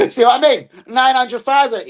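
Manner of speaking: exclaiming. Acted. Acts out repeated catchphrase.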